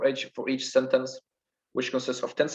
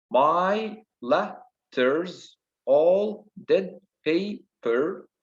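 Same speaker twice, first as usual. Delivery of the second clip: "My letters, all dead paper" drawn out slowly, higher; stressed-unstressed rhythm; 5 semitones higher, much slower than usual. Shown as a demonstration. Teacher showing the rhythm to students, stressed and unstressed beats. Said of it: "This is the rhythm".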